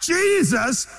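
slow, high, tearful proclamation; crying shout. Real, not acted. Preacher to congregation, a slow tearful shout. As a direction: cry it out slowly with full force.

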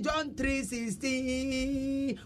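very slow, drawn-out pupil's recital; schoolchild reciting. Acted. Pupil answering the teacher's question, reciting slowly in a long singsong. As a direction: stretch the last syllable like a child reciting. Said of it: "akwadaa bi na ɔkɔ school".

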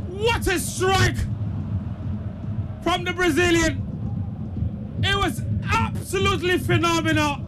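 excited football commentator, pitched high; thrilled goal commentary. Real, not acted. Commentator relives the goal live.

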